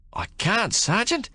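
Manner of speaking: put-on high, hesitant young soldier's voice; reluctant young private. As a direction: refuse the sergeant nervously.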